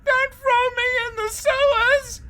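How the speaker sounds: high, loud terrified begging; desperate plea. A narrator's reading. Helen begging the Bogman, high and loud. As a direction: beg for her life.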